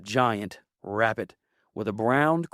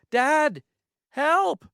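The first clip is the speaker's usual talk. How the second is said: very high, louder panicked shout; child's cry for help; 4 dB louder than usual. Acted. Benjamin calls his dad amid the bunnies, panicked high shout. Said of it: "so he just shouted".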